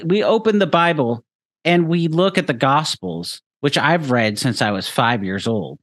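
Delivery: ordinary talk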